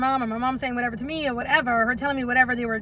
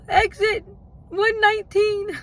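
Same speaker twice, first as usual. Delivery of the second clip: through tears; 7 semitones higher, slower than usual. Real, not acted. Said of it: "through my tears".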